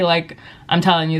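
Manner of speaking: usual speaking voice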